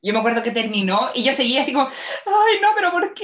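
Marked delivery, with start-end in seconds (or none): 1.9-3.2 high, whiny mock anguish; imitating crying at the end; mock wail. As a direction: wail it like the film broke you. Crying over Hachiko ending.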